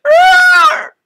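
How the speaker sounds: very high, loud cockatrice shriek; monster screech. Acted. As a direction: screech like a rearing bird-monster. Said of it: "it's like".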